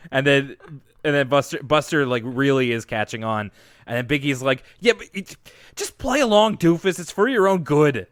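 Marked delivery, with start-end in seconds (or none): 4.8-8.1 cracking, bratty. Retold or imitated. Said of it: "that was what cracked".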